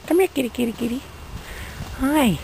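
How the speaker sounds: sing-song call to coax the kitty over; calling the cat. Real, not acted. Calling the neighbor's cat in a high coaxing voice.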